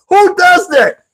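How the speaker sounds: high, laughing, slow "who does that"; laughing disbelief. Real, not acted. Comedian baffled by his date, laughing high disbelief. Reacting to date's habit.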